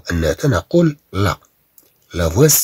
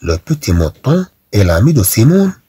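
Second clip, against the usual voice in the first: slow, louder classroom read-aloud; 5 dB louder, much slower than usual. Shown as a demonstration. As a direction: read each sentence aloud for the class to follow. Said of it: "إذا نعيد". (قراءة جهرية بطيئة للقسم)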